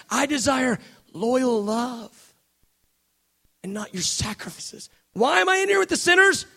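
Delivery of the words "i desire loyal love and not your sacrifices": slow reverent quote. Acted. Jesus quoting Hosea slowly.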